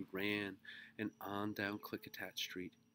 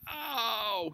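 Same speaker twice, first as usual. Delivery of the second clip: loud, high cry of pain; pained groan; 12 dB louder than usual. A narrator's reading. Mister Grumby with wood on his toe, pained groan. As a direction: groan out a sharp hurt. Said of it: "he groaned".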